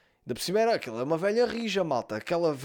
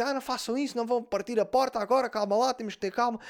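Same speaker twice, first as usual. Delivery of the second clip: mãe aflita imitada; 7 semitones higher than usual. Retold or imitated. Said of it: "ouvia a mãe dela dizer assim".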